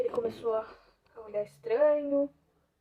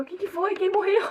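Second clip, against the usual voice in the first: imitação de mãe em pânico, aguda e alta; mãe alarmada; 9 semitones higher, 8 dB louder than usual. Retold or imitated. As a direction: dispare a pergunta como mãe assustada, exagerando. Mãe alarmada, encenada ao telefone. (alarme encenado, subindo até quase gritar)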